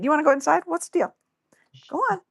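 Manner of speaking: high, coaxing call to the dog. Real, not acted. Speaker to her dog at the door, coaxing high voice.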